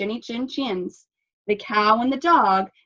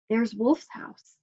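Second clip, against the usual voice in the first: ordinary delivery, nothing marked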